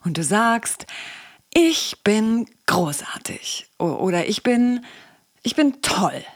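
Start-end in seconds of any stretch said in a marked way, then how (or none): 1.5-3.7 acted, slow and emphatic self-affirmation; drawn-out, deliberate mock pep talk; affirmation said aloud. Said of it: "oder eben laut gesagt". 4.3-6.2 higher-pitched, emphatic self-affirmation; acted, raised-pitch pep talk to oneself; affirmation said aloud. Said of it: "oder eben laut gesagt".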